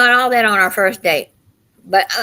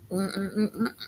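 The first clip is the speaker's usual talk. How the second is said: yummy hum; 12 dB quieter than usual. Real, not acted. Eating a cookie, savoring it.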